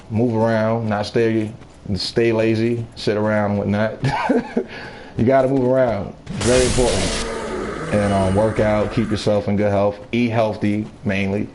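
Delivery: normal talking voice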